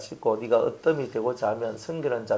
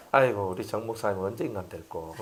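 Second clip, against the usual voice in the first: softer, lower condescending sigh; condescending sneer; 6 semitones lower, 7 dB quieter than usual. Retold or imitated. Pastor mimics a proud believer belittling a minister. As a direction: look down on him with a sigh. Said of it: "욕하고 있거든요".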